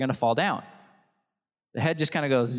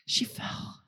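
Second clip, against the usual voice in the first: mock disappointed whisper; 5 dB quieter, slower than usual. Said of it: "We don't go".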